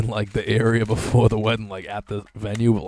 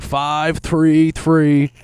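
very slow, drawn-out numbers like an address; 5 semitones higher, 5 dB louder, much slower than usual. Retold or imitated. Prank caller reading digits to the clerk, very slow. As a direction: drag each number out slowly. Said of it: "he'd be like".

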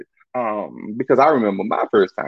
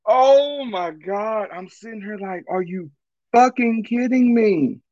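stunned disbelief; 9 semitones higher than usual. Real, not acted.

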